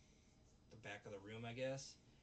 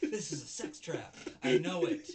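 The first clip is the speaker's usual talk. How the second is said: louder, half-laughing paranoid cry; mock panicked outburst; 4 semitones higher, 13 dB louder than usual. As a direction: blurt it like a man sure he's trapped. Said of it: "he's like".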